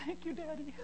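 tiny, very high, soft crying voice; mock tearful child. Kid thanks his dad for duct tape, mock crying. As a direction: squeak out tearful gratitude like an overwhelmed little boy. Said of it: "his kid just starts crying".

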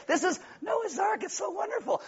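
high, small, softened cutesy voice; mock sweet gushing. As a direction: coo it with sugary fake wonder. Mocking cute Noah's Ark toys.

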